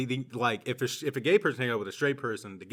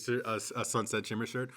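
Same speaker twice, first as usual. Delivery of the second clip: ordinary talk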